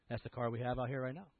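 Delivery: ordinary delivery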